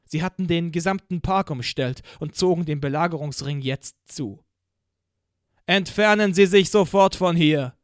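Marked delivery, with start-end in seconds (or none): 5.7-7.8 loud, higher, booming command over a PA; Lärm is no part of it, just a thundering megaphone order; thundering police loudspeaker. Acted. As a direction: order the crowd off like a voice from the sky. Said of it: "sagte die Stimme Gottes noch einmal".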